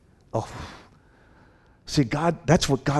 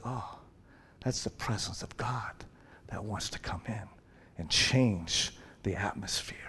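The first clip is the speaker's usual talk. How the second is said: hushed, breathless whisper; awed whisper; 3 semitones lower, 9 dB quieter than usual. Retold or imitated. Onlooker whispers about God's presence, in awe. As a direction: whisper it as if sensing something holy.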